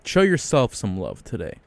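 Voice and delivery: ordinary delivery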